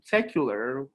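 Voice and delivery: normal talking voice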